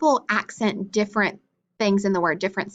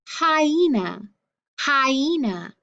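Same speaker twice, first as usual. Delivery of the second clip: hyena with HI drawn out and lifted; stress on HI; 4 semitones higher, much slower than usual. Shown as a demonstration. Teacher demonstrating the accented syllable in hyena, HI stressed. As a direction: stretch the HI of hyena, slowly. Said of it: "The hi".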